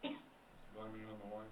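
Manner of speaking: soft, slow scornful repeat; mocking echo. Real, not acted. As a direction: echo it back with disbelief. Echoes the excuse mockingly.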